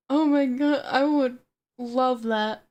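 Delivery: ordinary delivery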